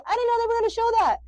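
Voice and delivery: high, fast astonished pastor; surprised pastor. Retold or imitated. Pastor reacting to the video, hammed-up astonishment. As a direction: blurt it like a shocked organized man. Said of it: "he literally goes".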